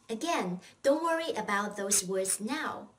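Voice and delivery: ordinary delivery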